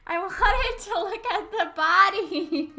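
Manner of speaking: loud complaining wail; frustrated whine. Real, not acted.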